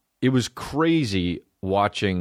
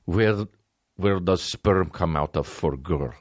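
put-on Russian accent; 2 semitones lower, slower than usual. Russian voice in quoted dialogue.

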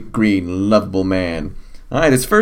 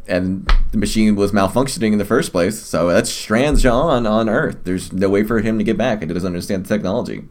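normal talking voice

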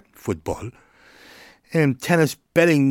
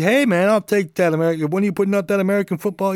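higher, fast, lightly voiced fan asking; eager commenter; 6 semitones higher, faster than usual. A viewer asking when football goes up, lightly voiced eager fan. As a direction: rattle it off like an impatient fan. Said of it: "leave a comment and say".

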